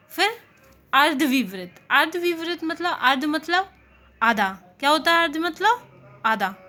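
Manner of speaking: usual speaking voice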